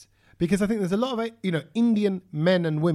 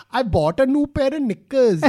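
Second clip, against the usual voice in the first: auntie tone; 6 semitones higher than usual. Retold or imitated.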